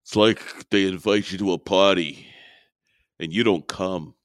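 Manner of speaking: slurred action-hero impression with long pauses; Sylvester Stallone. Co-host quoting Rambo's reply, Stallone impression. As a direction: imitate Rambo, halting between phrases. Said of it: "And Rambo says the following".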